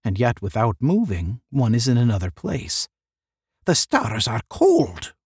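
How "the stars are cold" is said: slow, understated cry. A narrator's reading. One lamenting the cold stars, crying out mildly. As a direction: call it out slowly, mildly. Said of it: "one cried".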